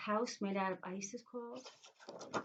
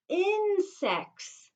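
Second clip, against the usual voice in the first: much higher and louder, falling at the end; excited reveal; 11 semitones higher, 8 dB louder than usual. Shown as a demonstration. Teacher to class, excited answer reveal. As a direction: burst out the answer triumphantly.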